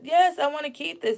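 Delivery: high, loud and defiant; indignant retort. Retold or imitated. Retelling a nurse's insult to viewers, high indignant retort. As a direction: throw the answer back at her, offended.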